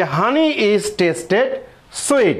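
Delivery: each word drawn out for copying; teacher dictating the new version slowly; slow dictation. Shown as a demonstration. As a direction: dictate it slowly for the class to copy. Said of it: "latest updated digital পদ্ধতি".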